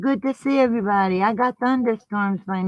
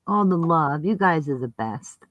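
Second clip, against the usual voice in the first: usual speaking voice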